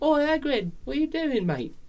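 put-on high, cheeky English kid calling out; British kid accent. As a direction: taunt him like a street kid from England. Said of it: "That was pretty good".

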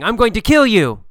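loud, high threat shouted at someone; threatening yell. Shown as a demonstration. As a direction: yell it like you mean to kill. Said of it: "yelling at you".

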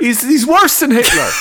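laughing through the words, pitch up. Real, not acted. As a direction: crack up while saying it.

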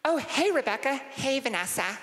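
put-on high, girly dorm-room greeting; put-on female student voice; college girl. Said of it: "what I imagine dorm life".

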